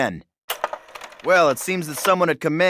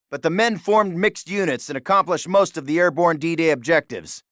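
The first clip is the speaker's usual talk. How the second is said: ordinary talk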